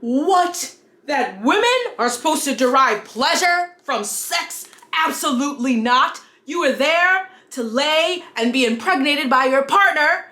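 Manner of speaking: outraged prudish character, hammed up; hammy stage actor. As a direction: overplay a scandalized conservative. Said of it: "thank you if you watched through my terrible skit".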